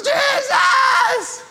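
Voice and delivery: high desperate shout; desperate cry. Retold or imitated. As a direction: call out like you're drowning. Desperate new believer crying out.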